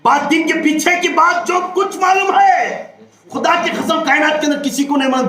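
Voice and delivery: high, shouted sermon proclamation; impassioned preaching. Real, not acted. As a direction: proclaim it to the whole congregation. Preaches at full force.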